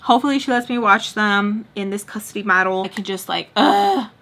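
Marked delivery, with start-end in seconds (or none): 3.5-4.1 high, loud "ugh" burst; exclaimed ugh. Retold or imitated. Longing to enjoy time with the kids, high loud ugh. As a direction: let out a raised-pitch ugh. Noise in place of words.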